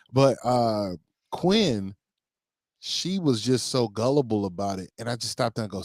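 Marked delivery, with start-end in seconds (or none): none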